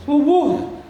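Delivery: high squawky parrot calling subuh. Retold or imitated. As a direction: mimic a parrot crying out the dawn call.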